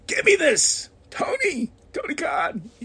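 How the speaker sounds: high-pitched pleading call to the boss; excited plea. Retold or imitated. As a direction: beg for the match. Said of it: "And I'm like".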